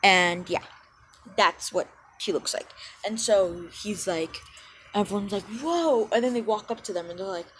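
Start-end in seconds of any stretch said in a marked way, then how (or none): 5.6-6.1 high mock whoa; awed crowd. Classmates awed by matching suits, high whoa. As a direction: gasp in awe at the suits.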